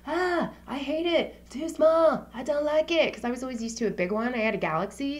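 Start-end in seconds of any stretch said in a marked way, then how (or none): 0.1-3.1 higher, slow whiny gripe; playful imitation of her own past complaining; mock whiny complaint. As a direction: whine about the phone like a brat. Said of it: "I'm like".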